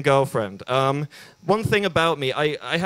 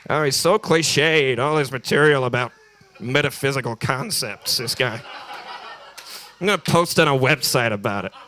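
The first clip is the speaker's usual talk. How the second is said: mock complaining critic. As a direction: act out a dismissive punter moaning. Acts out a complaining audience member.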